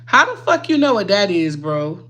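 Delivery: loud incredulous retort; retold outraged comeback. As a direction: throw it back at him, offended. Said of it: "I said".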